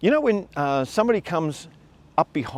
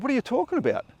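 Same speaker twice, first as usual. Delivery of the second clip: baffled protest; 10 semitones higher, 4 dB quieter than usual. Retold or imitated. Confused reply to boss's accusation.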